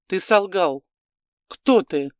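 sharp angry shout. Acted. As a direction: shout it in accusation. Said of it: "закричал он".

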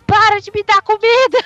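high, loud desperate yell; frantic yelling. Retold or imitated. Thumb inside the cow shrieks at the owner, high and loud. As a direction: shriek it out at full force. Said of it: "tá a vaca gritando".

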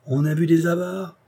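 high-pitched insinuation; hint slyly at a secret; knowing hint. A narrator's reading. Doctor hints at a hidden hoard.

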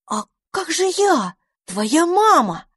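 high, slow plaintive voice; pleading little Dandelion. A narrator's reading. Dandelion appeals as dragon's mama, high plea. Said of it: "попробовал вмешаться одуванчик".

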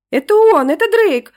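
high, loud joyful cry; excited shout. Acted. Angelinka shrieking with joy at the intercom. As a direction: burst out with joy. Child excitedly recognizes dog.

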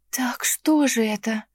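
несчастный шёпот, медленно; жалобно, почти без голоса; несчастным голосом. Acted. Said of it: "несчастным голосом проговорила я". (несчастным шёпотом, растерянно)

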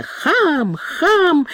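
put-on high accented yelps; a hint of a tumbling man's yell; shouting insults. A narrator's reading. Cook shouts while tumbling down the stairs, high yelps. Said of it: "выкрикивал он".

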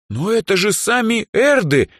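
high, loud outraged fan; indignant girlish outburst; strongly indignant. A narrator's reading. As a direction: protest as if everyone must know. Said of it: "возмутилась Даяна так сильно".

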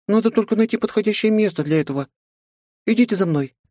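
tiny high fairy voice; pixie. A narrator's reading. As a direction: chirp it like a small fairy. Fairy Kat speaks.

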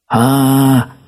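high sustained "aaah" yell; storybook bear cub's loud holler; acted scream. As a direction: cry out long and high. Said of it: "закричал медвежонок".

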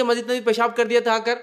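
usual speaking voice

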